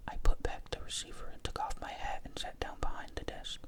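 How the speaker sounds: ordinary delivery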